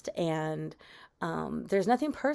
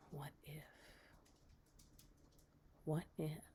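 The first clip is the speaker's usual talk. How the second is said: hushed horror narration; 4 semitones lower, 20 dB quieter than usual. A narrator's reading. Storyteller leaving listeners with what if, hushed. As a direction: let each eerie thought hang in a hush. Suspenseful ghost story ending.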